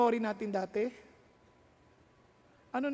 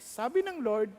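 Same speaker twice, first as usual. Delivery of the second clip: ordinary delivery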